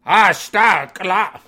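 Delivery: sudden loud yell to frighten someone; scary shout. Shown as a demonstration. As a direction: bark it out to scare a kid. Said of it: "When you want to scare somebody".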